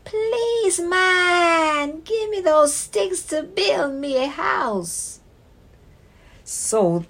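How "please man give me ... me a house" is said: high squeaky piglet voice; small pleading pig; little pig voice. Acted. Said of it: "and said".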